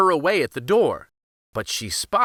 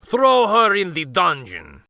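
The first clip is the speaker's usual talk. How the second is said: a loud, higher barked command; ordering; 5 semitones higher, 6 dB louder than usual. Acted. Vizier ordering his guards to throw the girl in the dungeon. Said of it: "ordered the Grand Vizier".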